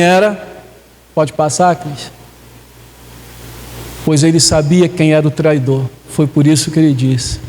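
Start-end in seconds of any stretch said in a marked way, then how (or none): none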